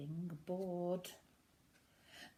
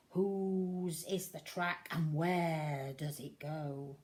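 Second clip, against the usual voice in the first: usual speaking voice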